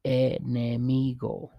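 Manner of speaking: enemigo, said slowly, syllable by syllable; slow model word. Shown as a demonstration. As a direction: stretch out enemigo clearly for the class.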